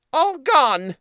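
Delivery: high, loud little mouse blurting it; squeaky mouse letting it slip. A narrator's reading. Mouse blurts the last name.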